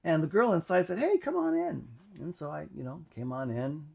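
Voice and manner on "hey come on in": much higher, bright friendly call from a dorm room; cheery girl's invite. Retold or imitated. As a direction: sing out a welcome like the girl inside. Girl in dorm invites him.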